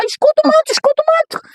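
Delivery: squeaky high chant, shrill with joy; high excited squeal. Acted. Telling the host her baby is coming, squealing high.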